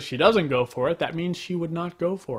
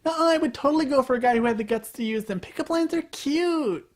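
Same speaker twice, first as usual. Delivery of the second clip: put-on high, gushing girly whine, slowing down; mocking girly voice; 8 semitones higher than usual. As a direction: coo it like a starry-eyed girl. Quoting girls, mocking girly voice.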